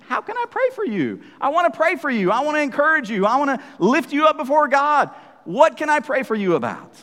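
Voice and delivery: high, fervent offer of support; building preacher's encouragement in a friend's voice; earnest appeal. Acted. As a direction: plead eagerly, pitched well up. Voicing an earnest, eager offer of prayer.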